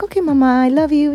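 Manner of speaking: put-on high, quick little boy voice; mother imitating her son saying goodbye, high and quick; toddler voice. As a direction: chirp it like a cheerful toddler. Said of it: "He's like".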